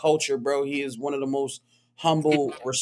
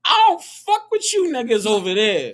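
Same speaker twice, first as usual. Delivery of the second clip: high, animated yard trash talk; mock hostility; 8 semitones higher, 6 dB louder than usual. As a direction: act out a hyped-up brush-off of rivals. Acts out rivalry at the yard.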